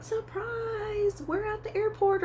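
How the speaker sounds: high, drawn-out singsong "surprise"; high singsong mock reveal; imagined surprise announcement. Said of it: "expecting them to say".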